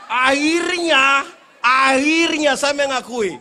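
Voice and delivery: very slow, loud drawn-out shout; laughing shout. Real, not acted. Preacher shouting to the crowd while laughing, slow and loud. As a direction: stretch it out laughing.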